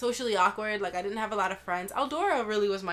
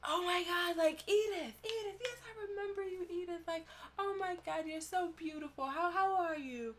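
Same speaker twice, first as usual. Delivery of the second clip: much higher, softer gushing greeting; gushing teacher; 10 semitones higher, 7 dB quieter than usual. Retold or imitated. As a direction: imitate him fawning over her, high and sweet. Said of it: "he was like".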